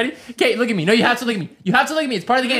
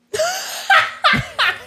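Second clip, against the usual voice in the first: high squeal laugh. Real, not acted. Out of jokes, laughs.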